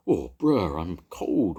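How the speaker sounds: a mild shivering wail. A narrator's reading. The cooled dragon complaining of cold, mildly wailing. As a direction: moan with cold. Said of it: "wailed the dragon".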